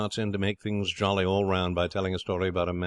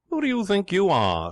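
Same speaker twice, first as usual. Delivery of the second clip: much higher, louder angry challenge; indignant, breathing hard; 12 semitones higher, 5 dB louder than usual. Acted. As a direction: demand it, worked up and indignant. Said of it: "breathing hard".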